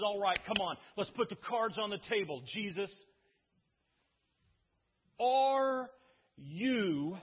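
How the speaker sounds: loud, pressing demand from a high priest; frustrated challenge. Retold or imitated. High priest loudly demanding Jesus admit he is the Christ. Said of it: "he's growing increasingly frustrated".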